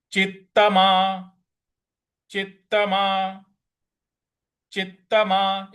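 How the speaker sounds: चित्तमा with a doubled, held त्त; careful recitation. Shown as a demonstration. Teacher drilling the class on चित्तमा, slowly. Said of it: "इस प्रकार से बोलना है".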